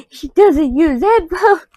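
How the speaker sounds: "headphones" wailed high, slow and loud, half crying; mock-despairing cry on "headphones"; exasperated wail. Shown as a demonstration. Complaining to viewers about roommate, mock wailing. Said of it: "And she doesn't use headphones".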